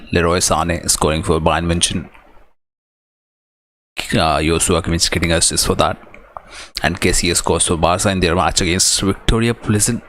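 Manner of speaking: ordinary talk, nothing marked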